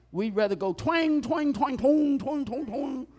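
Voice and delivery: sing-song twang, making fun; mock twang. Preacher mocks shallow songs to the church, hammed-up.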